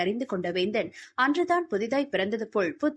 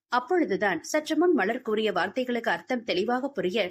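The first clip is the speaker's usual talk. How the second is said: ordinary delivery, nothing marked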